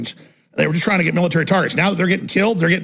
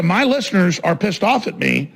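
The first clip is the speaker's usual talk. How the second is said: ordinary talk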